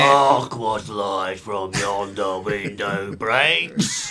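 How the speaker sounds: Shakespeare recital. Acted. Bandmate mock-recites Shakespeare, low slow theatrical voice. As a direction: declaim it like an old actor treading the boards. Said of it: "A little bit of Shakespeare".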